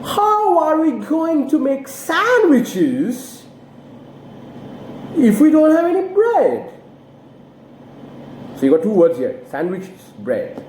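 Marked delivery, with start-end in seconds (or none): 0.0-6.7 high, slow, careful model reading; example sentence intonation. Shown as a demonstration. Teacher modelling intonation for learners, slow and high. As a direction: fall firmly on "bread", keep "sandwiches" new and level. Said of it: "I'll give you two examples".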